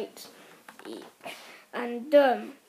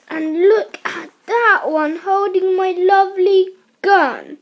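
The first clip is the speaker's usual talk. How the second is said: loud, high-pitched outraged shouting; indignant shout; 7 semitones higher, 9 dB louder than usual. A narrator's reading. Mister Gregg seeing his gun taken, indignant shout. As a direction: shout it out, aghast at the cheek. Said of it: "shouted Mister Gregg".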